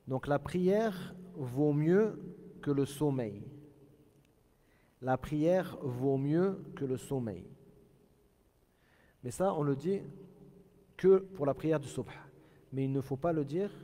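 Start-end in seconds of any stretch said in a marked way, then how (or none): none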